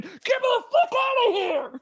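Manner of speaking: high, slow, laughing chef yell; furious chef. Acted. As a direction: bellow from the distance like an angry chef. GM voices the chef in the freezer.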